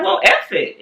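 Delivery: sharp high-pitched comeback; high mock retort. Mocking retort back at husband.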